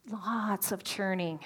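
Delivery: ordinary talk, nothing marked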